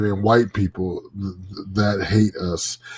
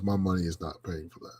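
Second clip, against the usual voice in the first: imitating a Cuban father, lower and quieter; low, soft refusal in a Cuban accent; 5 semitones lower, 11 dB quieter than usual. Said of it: "in his thick Cuban accent".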